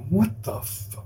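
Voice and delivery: whispered, cut-off curse; whispered disbelief. Real, not acted. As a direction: breathe it out in shock. Stunned on getting the email.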